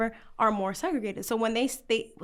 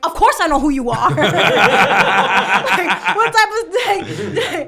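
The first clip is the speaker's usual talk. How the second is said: louder, laughing, cocky answer; loud laughing retort; 4 semitones higher, 9 dB louder than usual. Retold or imitated. Student answering Spike Lee, loud and laughing. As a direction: tell him you know him, laughing. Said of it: "And I'm like".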